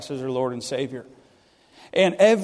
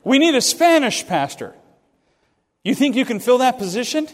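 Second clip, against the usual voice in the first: higher, louder, eager pitch of the offer; booming offer; 6 semitones higher, 4 dB louder than usual. Retold or imitated. Preacher voicing his old pastor's offer, loud and eager. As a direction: sell it big and bright.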